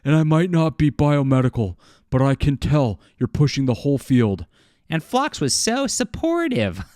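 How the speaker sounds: mock falsetto read of a comic line; mocking put-on voice. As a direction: ham up a mocking caricature voice. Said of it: "that's my transgender voice now".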